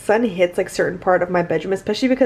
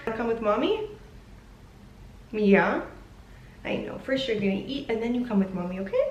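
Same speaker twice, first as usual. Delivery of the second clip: talking to the dog; 10 dB quieter than usual. Real, not acted.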